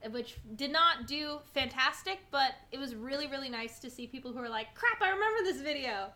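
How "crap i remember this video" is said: higher-pitched imitation of thrilled fans; excited fan reaction. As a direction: mimic viewers suddenly recognizing an old favorite. Voicing fans recognizing old video.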